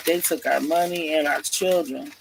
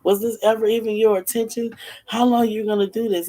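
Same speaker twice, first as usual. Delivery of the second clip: higher, louder upset father; 8 semitones higher, 4 dB louder than usual. Retold or imitated. Father confronts the kidnappers about his children, upset. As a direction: demand answers, pitched up and agitated. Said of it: "and he's getting upset".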